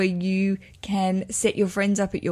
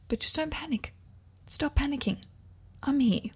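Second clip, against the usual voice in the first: soothing; 7 semitones higher, 6 dB quieter than usual. Real, not acted. Soothing listeners.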